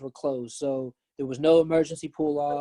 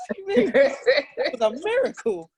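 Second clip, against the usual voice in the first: high, laughing protest; 14 semitones higher, 5 dB louder than usual. Real, not acted. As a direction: laugh through the outrage. Laughing at ex's reaction.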